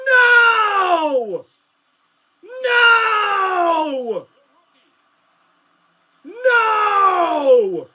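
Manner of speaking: crying out No in despair; anguished cry. Real, not acted. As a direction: wail each No, heartbroken. Distraught fan reacting to interception.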